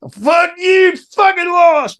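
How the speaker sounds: high, loud acted outburst; imitated angry shouting; Gary. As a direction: yell it like a furious sore loser. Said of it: "He's going".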